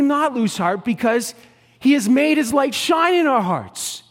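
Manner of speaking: loud, high preaching voice; full-throated sermon climax; fiery preaching. Acted. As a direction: preach it out to the whole room.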